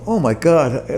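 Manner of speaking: a retold gasp of dismay through a laugh; laughing oh my God. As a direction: laugh it out in mock horror. Retold panic at Reed's slides.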